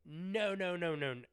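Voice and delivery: loud protest; loud insistent protest. Retold or imitated. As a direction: shut him down firmly. Arguing over his ticket number.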